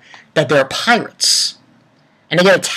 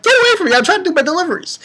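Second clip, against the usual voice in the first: annoyed Amy; 11 semitones higher, much faster than usual. Retold or imitated. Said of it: "And she's like".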